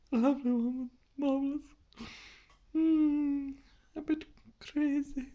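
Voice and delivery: soft, small falsetto; hushed, high-pitched and dreamy; high dotty musing. Acted. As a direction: muse quietly in a high little voice. Drifts into musing about Mummy.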